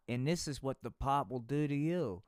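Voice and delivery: ordinary delivery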